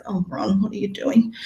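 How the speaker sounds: soft, half-whispered mock disbelief; husband's exasperation. Coach imitating her husband's quiet disbelief. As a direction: hush it like a weary husband. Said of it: "My husband just goes".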